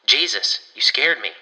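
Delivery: loud startled cry; startled yelp. Acted. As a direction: jump and yelp in fright. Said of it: "Warren yelps".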